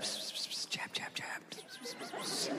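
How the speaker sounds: rumour hiss in a whisper; unvoiced pss of whispers. Shown as a demonstration. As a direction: hiss it like gossip. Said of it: "whispers of a vaccine".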